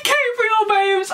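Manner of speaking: tearful. Real, not acted. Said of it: "I'm literally crying".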